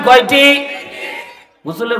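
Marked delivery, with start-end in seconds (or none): 0.0-0.7 high, loud demand for an answer; louder crowd prompt. Real, not acted. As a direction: call it out to the whole crowd. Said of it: "জোরে বলেন". (উঁচু গলায় জোরে উত্তর চাওয়া)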